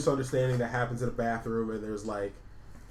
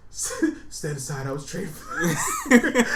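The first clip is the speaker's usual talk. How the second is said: slow, high, laughing mock hero; laughing heroic line; 11 semitones higher, 6 dB louder, much slower than usual. S0 retelling the rescuer's line, laughing slowly and high. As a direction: crack up while playing the rescuer. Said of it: "retold hero line, laughing".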